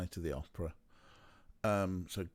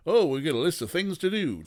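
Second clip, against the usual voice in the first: mock dramatic voice; 7 semitones higher, 8 dB louder than usual. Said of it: "Just like".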